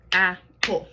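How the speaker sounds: Apple split into two clapped beats, ap-ple; teacher modelling Apple in beats; clapped syllables. Shown as a demonstration. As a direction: clap out Apple beat by beat. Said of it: "let's sound it out and clap it".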